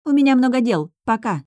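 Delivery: breezy high-pitched dismissal; tossed-off parting remark. A narrator's reading. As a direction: toss it over your shoulder while leaving. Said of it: "бросив".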